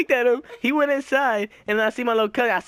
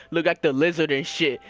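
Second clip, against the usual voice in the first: normal talking voice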